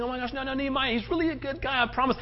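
mock the family vouching for Tobiah; pleading relatives, lightly mocked; gushing relatives. Voices family defending Tobiah.

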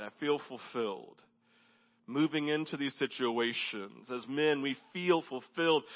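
hushed intimate preaching. Acted. Pastor speaking to the men, hushed and earnest. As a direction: draw them in close, quietly.

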